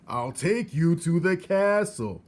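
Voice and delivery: high, loud and slow shout; loud, slow, drawn-out shout. A narrator's reading. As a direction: spell it out loudly to a deaf girl. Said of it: "shouted the soldier".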